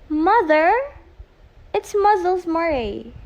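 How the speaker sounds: loud call to another room. Acted. Daughter calls out to mother from the phone. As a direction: shout for mother.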